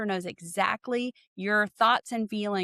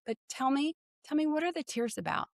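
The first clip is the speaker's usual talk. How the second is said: gentle reassuring; 5 semitones higher, 5 dB quieter, faster than usual. Retold or imitated. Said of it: "I go".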